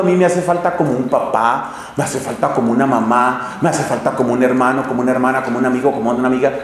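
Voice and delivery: low, quick, monotone list of wants; mock needy thoughts. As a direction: recite the longings under your breath, speeding up. Voices a needy youngster's thoughts. (imitando pensamientos de carencia, bajito y monótono)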